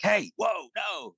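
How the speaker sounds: retold alarmed yell. Father yelling at his daughter behind a swing, high alarmed shout. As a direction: yell it out to stop a child running into danger. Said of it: "just kinda started yelling".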